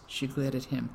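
plain reading voice

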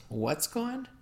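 high, puzzled question. A narrator's reading. Father asking Missus Gavelli what is missing, puzzled. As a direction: ask it in bewilderment. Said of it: "My father looked puzzled".

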